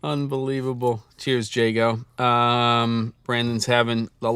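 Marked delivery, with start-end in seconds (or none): none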